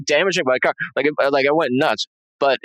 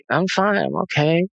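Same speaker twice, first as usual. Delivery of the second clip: higher-pitched, lightly voiced dazed wife; imitating dazed wife; 6 semitones higher than usual. Half-lidded wife answering worried husband, mildly dazed. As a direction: mimic her dazed reassurance in a raised pitch. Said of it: "half closed".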